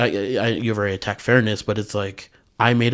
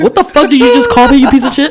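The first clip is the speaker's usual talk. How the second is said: loud high furious shout; mock outrage; 13 dB louder than usual. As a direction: scream it, offended and laughing. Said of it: "I'd be like".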